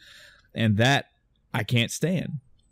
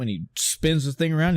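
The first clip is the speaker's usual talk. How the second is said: ordinary talk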